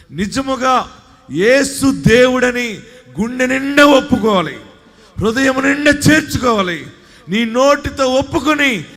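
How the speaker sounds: high, loud preacher's shout of faith; preaching at full force. Acted. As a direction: lift the church with a ringing call to confess Jesus.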